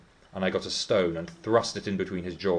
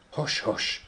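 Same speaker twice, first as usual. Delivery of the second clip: hushing whisper; 7 semitones higher than usual. Acted. Nelly hushes Heathcliff.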